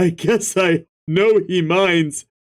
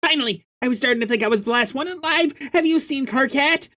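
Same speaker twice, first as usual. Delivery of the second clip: put-on high quick girl voice; 9 semitones higher, 3 dB quieter, much faster than usual. Fast high girl character greeting.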